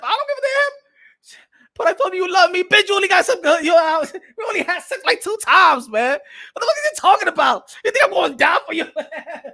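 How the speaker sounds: high, loud comic ranting; mock-incredulous yelling; outraged refusal. Man refusing to die for her, high loud outrage.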